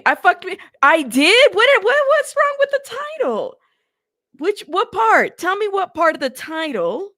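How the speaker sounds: high, loud squeal of disbelief; sudden high loud alarm over the title; surprised outcry. Real, not acted. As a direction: shriek it out, can't believe it. Excited outburst over the title.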